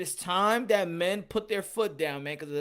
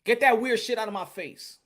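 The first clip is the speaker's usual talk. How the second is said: louder, higher, firm command; louder demo of pushing someone away; 4 semitones higher, 6 dB louder than usual. Shown as a demonstration. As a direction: say it like you mean it. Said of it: "That's the way you gotta say it".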